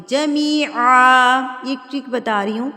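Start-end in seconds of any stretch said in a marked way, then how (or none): 0.1-1.4 جمیعا with a throaty ع, slow and louder; clear ain. Shown as a demonstration. Teacher corrects students' missing ain in جمیعا, slow. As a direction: press out the ع in جمیعا. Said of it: "عین کی آواز نکلے گی".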